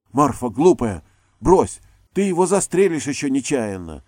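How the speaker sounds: loud, high alarmed cry; frightened shout. A narrator's reading. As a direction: shout in panic to stop her. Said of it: "испуганно закричала Софья".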